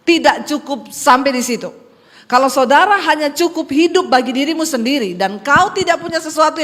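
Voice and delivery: loud sermon repeating the verse; forceful preaching. Acted. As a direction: press the verse on the congregation.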